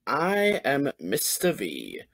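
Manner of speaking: plain reading voice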